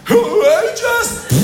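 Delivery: mock excited cheer. Residents' spokeswoman voting for jazz, mock excited cheer. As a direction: cheer it out, pitch shooting up.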